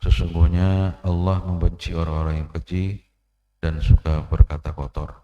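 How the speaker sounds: loud, slow pulpit recitation of the hadith; slow, loud sermon opening; forceful preaching. Acted. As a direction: proclaim it to the congregation, weighing each phrase.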